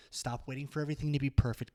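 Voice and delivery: ordinary delivery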